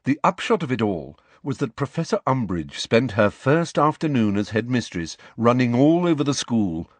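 ordinary delivery

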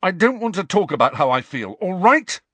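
put-on angry teenager near breaking point; shaking with rage. A narrator's reading. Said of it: "shaking with rage".

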